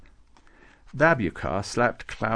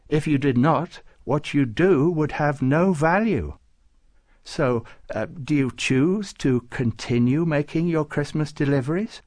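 raised, measured saintly delivery; lightly voiced saint, questioning; 5 semitones higher, 5 dB louder than usual. Nicholas asking Klaus about his deliveries, measured. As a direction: put the choice to him with some weight.

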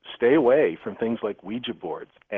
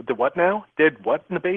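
baffled surprise; 8 semitones higher, 5 dB louder than usual. Retold or imitated. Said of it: "And I'm going".

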